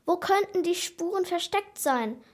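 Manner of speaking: plain reading voice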